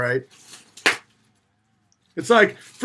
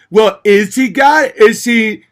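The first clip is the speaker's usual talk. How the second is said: mocking bickering; 4 semitones higher, 6 dB louder, slower than usual.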